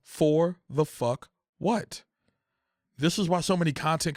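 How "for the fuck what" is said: slow, high, drawn-out question; drawn-out exasperation. Real, not acted. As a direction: stretch each word in disbelief. Repeated exasperated rhetorical question.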